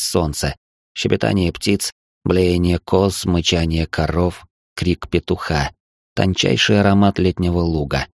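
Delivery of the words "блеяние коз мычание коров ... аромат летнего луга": ordinary delivery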